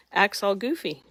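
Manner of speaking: ordinary delivery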